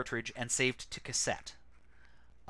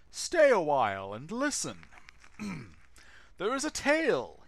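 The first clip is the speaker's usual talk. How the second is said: put-on wise old master; 5 semitones higher, 4 dB louder than usual.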